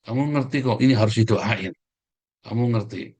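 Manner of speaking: ordinary talk, nothing marked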